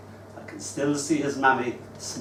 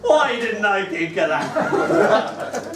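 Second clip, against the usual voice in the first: amused wonder; 6 semitones higher, 4 dB louder, much slower than usual. Retold or imitated. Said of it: "And I thought".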